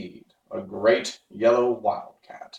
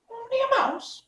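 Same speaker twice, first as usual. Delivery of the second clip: offended tiny mouse voice, much higher; indignant squeak. Acted. Mouse queen indignantly answers the Woodman, high squeak. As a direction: squeak back, insulted. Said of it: "cried the little animal indignantly".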